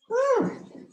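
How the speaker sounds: high breathy whew of relief; relieved exhale. Real, not acted. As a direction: blow out after the effort. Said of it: "Lots of grunting".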